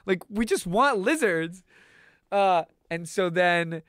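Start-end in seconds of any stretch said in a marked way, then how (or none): 0.0-1.6 put-on pitched-up, fast, pleading teen protest; high indignant protest. Teens telling the clerk they won't eat lizards, high and pleading. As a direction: insist you only want a pet. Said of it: "we're like".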